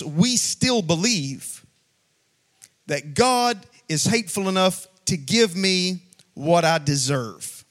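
normal talking voice

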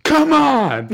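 high, loud exasperated come on; mock an irritated stranger; hammed-up annoyed heckle; annoyed fan. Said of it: "I just hear".

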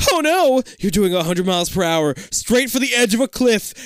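loud, high rushing alarm; narrating the limo racing to the cliff; panicked. Acted. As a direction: build the panic. Limo flying toward cliff.

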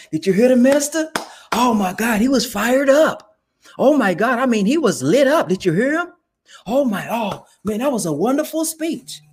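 a touch of breathless fan excitement; mock gushing fan. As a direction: play a starstruck fan after the speech. Mocking fans gushing over speech.

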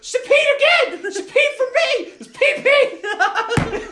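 shrieking falsetto panic, breaking into laughter; mock hysterics. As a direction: drag out the words in squealing panic. Hysterical report on the phone.

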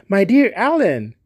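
high, loud outburst of protest; exclaimed cry. A narrator's reading. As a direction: cry out to a friend, pitched well up. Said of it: "cried Huey".

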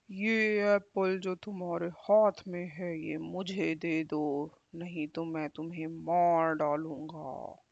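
big bad wolf threatening the mouse; menacing wolf. A narrator's reading. Wolf demands apple. (धमकाता Bhediya)

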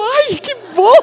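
high, loud squeal of joy; delighted. Retold or imitated. Preacher thrilled at hearing about the discount. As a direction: gush with glee.

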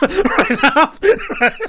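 the same line again, now high and cracking up; can't hold it together, laughing through the words. Retold or imitated. Friends joking about writing off receipts, laughing through it. Mock accountant repeating claim.

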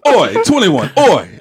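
hammed-up British heckle, laughing; mock British accent. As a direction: imitate a British crew through laughter. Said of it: "with the, uh, British accent".

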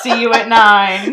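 loud, drawn-out playful call; mock cheery sign-off. Co-host playing the caller setting a photo appointment, mock cheery. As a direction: call it out slowly through laughter.

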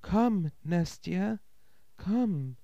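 high, restrained call to a friend; narrator's gentle version of a call. A narrator's reading. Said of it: "wiped her tears and called".

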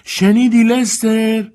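drawn-out loud high call; shouting again into the storm; slow shout. Acted. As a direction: stretch the call across the yard. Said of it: "باز فریاد زد".

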